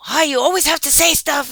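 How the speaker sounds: high-pitched mock tirade; imitated going off. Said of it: "Diana starts going off".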